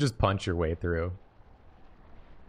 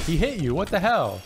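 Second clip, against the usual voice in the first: surprised; 9 semitones higher, 5 dB louder than usual. Real, not acted. Shocked the friend attacked.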